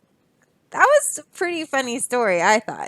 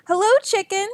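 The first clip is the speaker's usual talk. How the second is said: higher, cheery Duck greeting; 9 semitones higher, faster than usual. Acted. Duck on his bike greets Chicken, pitched-up hello.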